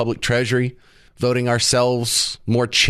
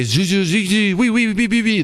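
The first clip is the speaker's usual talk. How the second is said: high-pitched gibberish French imitation; mock French; 7 semitones higher than usual. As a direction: babble like a caricature French speaker, pitched up. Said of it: "they speak French".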